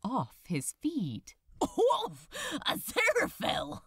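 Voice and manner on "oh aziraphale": gasped. A narrator's reading. Demon caught in the angel's embrace, surprised. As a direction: gasp his name in shock. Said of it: "gasped Crowley".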